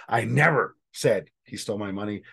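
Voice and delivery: "never" punched, rest dropping away; stress on never. Shown as a demonstration. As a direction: hit the word "never" hard, then pause. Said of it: "depending on which word you emphasize".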